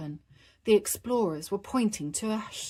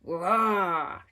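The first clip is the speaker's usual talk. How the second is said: put-on little boy's playful roar; cheering roar; 4 dB louder than usual. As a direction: roar happily like a tiny dinosaur. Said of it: "cheered George".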